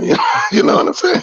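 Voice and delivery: high, fast laughing question; laughing at his own gorilla joke. Real, not acted. Joking with listeners about the gorilla line, laughing.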